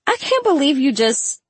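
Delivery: high-pitched teasing protest; incredulous, starting high; playful disbelief. A narrator's reading. Laughing protest to friend, cut off.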